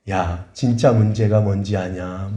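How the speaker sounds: low, very slow questioning; the Lord. Retold or imitated. The Lord questions a complaining spouse, low and slow. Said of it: "그런데 주님이".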